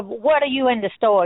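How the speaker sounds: ordinary talk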